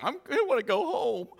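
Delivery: high, childish mock whine; whining father. Fathers squirming at a tough Father's Day lesson, mock whine. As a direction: whine like a reluctant kid. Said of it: "all the fathers are like".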